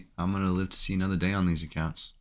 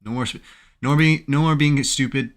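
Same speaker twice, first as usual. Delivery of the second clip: louder, higher scolding of himself; self-scolding; 6 semitones higher, 7 dB louder than usual. Real, not acted. Trader scolding himself after a bad trade, loud. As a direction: snap at yourself to stop. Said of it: "I need to stop being dumb".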